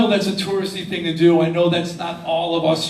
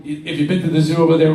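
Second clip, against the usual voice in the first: normal talking voice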